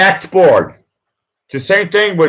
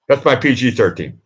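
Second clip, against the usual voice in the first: ordinary delivery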